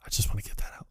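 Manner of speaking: barely voiced, breathy hush; whisper. Partner confessing in a hush under the bed, whispered.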